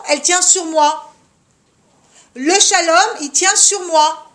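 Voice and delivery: loud, emphatic declaration; forceful insistence. Real, not acted. Teacher telling mothers the home rests on them, insistent.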